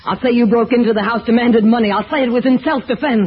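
loud, fast, high rant; frantic threat. Acted. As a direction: spit the threat out in a panic. Threatening Sam with gun.

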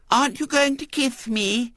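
put-on very high, lisping child voice; small-girl pleading; wheedling little girl. A narrator's reading. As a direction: ask coyly in a piping treble. Little girl asks for a kiss.

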